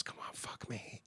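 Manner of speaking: hushed whispered come-on; retold whisper. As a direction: breathe it like a secret offer. Girl in club propositions him.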